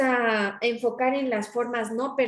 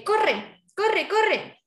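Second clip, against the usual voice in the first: a P.E. coach calling at pupils to run, higher pitched; coach urging on; 8 semitones higher, much slower than usual. Shown as a demonstration. P.E. teacher urging pupils to run, high and urgent. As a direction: urge the runners on, pitch up. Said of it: "cuando están en educación física".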